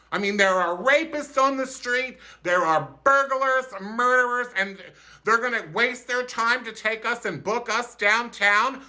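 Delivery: high, outraged disbelief; indignant inner rant. Retold or imitated. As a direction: rant in your head, scandalized. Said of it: "I'm thinking".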